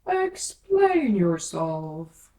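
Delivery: very slow, loud order; slow, loud caterpillar order; stern command. A narrator's reading. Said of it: "said the caterpillar sternly".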